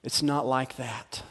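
Mary softly answering Joseph; soft slow denial. Acted. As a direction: say it tenderly, almost pleading.

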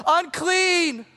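shouted warning, pitched high. Retold or imitated. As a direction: call it out to warn people off. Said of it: "shout out at the top of their lungs".

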